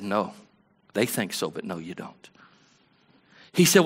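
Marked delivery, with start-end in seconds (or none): 0.0-2.3 quiet. Retold or imitated. Quiet reply to the Lord.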